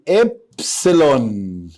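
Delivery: إبسلون stretched slowly as ebsilon; slow model pronunciation. Shown as a demonstration. Teacher correcting the child's letter name, slow and clear. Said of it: "الحرف ده أنا قلت لك اسمه إيه".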